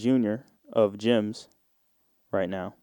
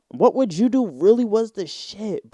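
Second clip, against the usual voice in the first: mock TV show announcer; 8 semitones higher than usual. Mimicking TV show title.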